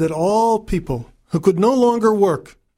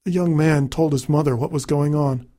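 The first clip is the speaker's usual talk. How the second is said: ordinary delivery, nothing marked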